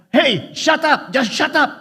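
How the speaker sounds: high, loud mock yell at the crowd; retold shout. Filipina wives shouting down Hatton fans, high mock yell. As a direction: bark it like an angry wife. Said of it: "yung mga Pinay".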